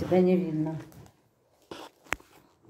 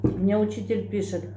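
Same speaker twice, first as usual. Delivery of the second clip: eating a burger between words; talking while chewing. Real, not acted. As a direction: mouth partly full of food. Seen 0: Bites at -4, appears to chew, bites again near 4.8.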